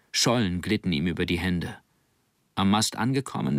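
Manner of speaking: ordinary delivery, nothing marked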